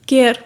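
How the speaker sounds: Care with the c sound hit hard; stressed first sound. Shown as a demonstration. Teacher demonstrates stress on care. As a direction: lean on the opening c of care. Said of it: "किस पे ज़्यादा ज़ोर डाल रही हूँ?".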